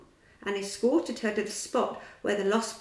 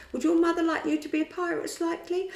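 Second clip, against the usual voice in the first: put-on boy's question; 9 semitones higher, 5 dB louder, faster than usual. A narrator's reading. Boy asks another.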